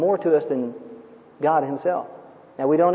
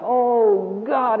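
raised, pleading cry to God; frightened man praying for rescue, pitched up; earnest plea; 4 semitones higher than usual. Retold or imitated. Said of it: "as he had never prayed in earnest before".

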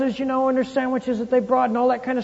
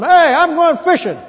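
higher, louder call of a restless fisherman; 7 semitones higher, 7 dB louder than usual. Retold or imitated. Peter announcing to his friends he is going fishing, loud call. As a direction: announce it loud to the whole crew. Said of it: "Can't you hear him?".

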